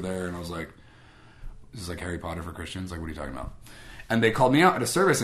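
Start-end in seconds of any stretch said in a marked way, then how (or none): 1.7-3.5 low, quiet disbelief; quietly incredulous, retold; skeptical aside. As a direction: drop it low, puzzled and dismissive. Retold private disbelief at strangers.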